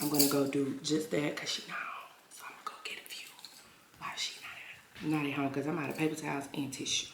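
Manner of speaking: conspiratorial whisper, unvoiced. Real, not acted. Whispering to viewers about taking mom's paper towels. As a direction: hush it like a secret plan. Sneaking supplies while mom away.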